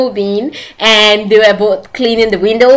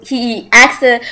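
normal talking voice

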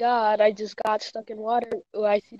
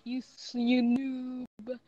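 taunting; much slower than usual. Acted. Taunting the chaser.

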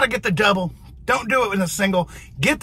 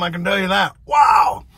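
ordinary talk

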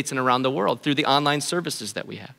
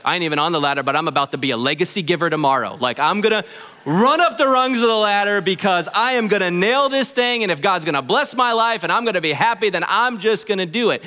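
hammed-up zeal to race up the ladder; competitive churchgoer; 3 semitones higher than usual. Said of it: "you might be like".

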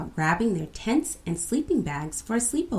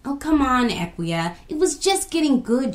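higher, louder objection; protesting shout; 5 semitones higher, 4 dB louder than usual. A narrator's reading. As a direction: push back eagerly. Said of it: "shouted Abinah".

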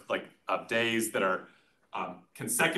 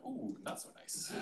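slow deflated whisper; whispered disappointed ooh; 16 dB quieter than usual. Retold or imitated. Seeing the coat's price tag, slow disappointed whisper. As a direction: let the air out as the price sinks in. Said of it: "and you're like".

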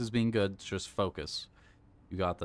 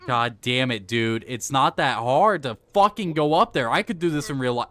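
loud, angry, higher; frustrated rant; 4 semitones higher, 9 dB louder than usual. Real, not acted. Gamer raging at a failed climb, loud and angry. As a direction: vent at the game like it cheated you.

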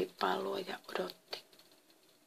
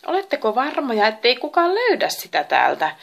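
raised, louder worried question; 4 semitones higher, 7 dB louder than usual. A narrator's reading. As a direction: ask anxiously, pressing for reassurance. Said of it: "kysyi Merkurius huolestuneena". (huolestunut, korotettu kysymys)

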